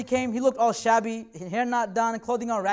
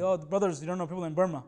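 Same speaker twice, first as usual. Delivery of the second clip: mock guilt-tripper; 7 semitones lower, 5 dB quieter than usual. Mocking a guilt-tripping brother.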